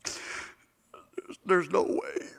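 breathless despairing whisper. Acted. Thief doubting he can be saved, hushed whisper. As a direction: barely breathe the words out.

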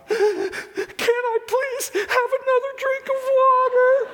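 put-on high, slow, whimpering little-girl plea; pleading little girl. Preacher imitating his daughter begging for water, high whimpering little girl. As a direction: beg sweetly like a tearful toddler.

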